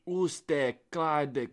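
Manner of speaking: hammed-up German accent. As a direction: bark out fake German words. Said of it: "Do a German one".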